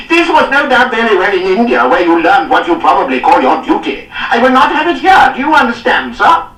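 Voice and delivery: high, loud furious bellowing; enraged lord berating a captain; raging. A narrator's reading. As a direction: tear into him with outrage. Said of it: "Cardigan raged on".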